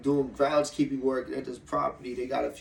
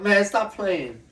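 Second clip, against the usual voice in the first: loud, high, drawn-out warning; mock telling-off; 7 semitones higher, 7 dB louder, much slower than usual. Retelling himself warning a kid, loud mock telling-off. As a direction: call out a playful reprimand, stretched and loud.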